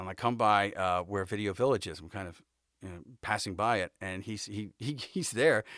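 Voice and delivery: usual speaking voice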